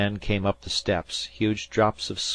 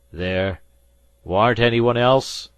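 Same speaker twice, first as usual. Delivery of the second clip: quavering voice; 5 semitones higher, 10 dB louder, slower than usual. A narrator's reading. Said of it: "his voice quavered".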